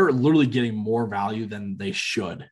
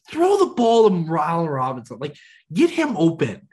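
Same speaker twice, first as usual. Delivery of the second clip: mock angry yell; 7 semitones higher, 5 dB louder than usual. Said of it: "Be like".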